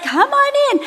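very high singsong invitation; welcoming call. Retold or imitated. Oblivious hostess inviting the caseworker in, high singsong welcome. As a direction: pitch it way up, an eager hostess.

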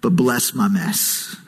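slow, low entreaty to God; drawn-out, solemn begging; pleading prayer. Retold or imitated. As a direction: spell out the plea word by word, pitched low. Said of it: "pleading with God to bless his mess".

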